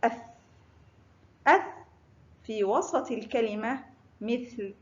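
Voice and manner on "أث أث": "أث" ending on a vowelless th; tongue out for thaa. Shown as a demonstration. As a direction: say "أث" with the tongue tip between the teeth. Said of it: "لا بد من إخراج جزء من اللسان". (نطق "أث" بإخراج طرف اللسان)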